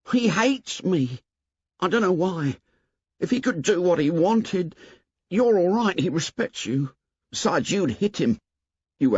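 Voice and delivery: put-on high, anxious boy's voice; fretful, insistent Piggy; worried plump boy pleading. A narrator's reading.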